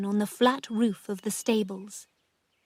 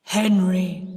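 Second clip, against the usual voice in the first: loud, drawn-out ghostly deep voice; 2 semitones lower, 5 dB louder than usual. A narrator's reading. Sis posing as dead Henry to her aunt, deep voice. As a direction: intone the name like a ghost. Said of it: "in the same deep voice".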